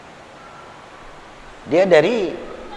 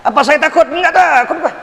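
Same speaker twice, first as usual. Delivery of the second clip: defiant cry; 10 semitones higher than usual. Real, not acted. Defiant rhetorical answer.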